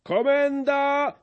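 high, slow, commanding; barked interruption. A narrator's reading. The sprinkler cuts in on Brzytewka, a high commanding cry. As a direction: cut in like a drill sergeant. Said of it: "przerwał chrzciciel".

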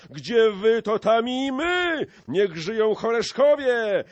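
very high, loud cheers; roaring rallying chant building to a war cry; crowd shouting. A narrator's reading. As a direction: whip up the mob with slow, stretched cries. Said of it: "krzyknęła szlachta".

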